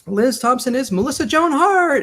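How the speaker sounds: imitated emcee introduction; warm-up guy. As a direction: announce the star to a studio audience. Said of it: "the warm-up guy is like".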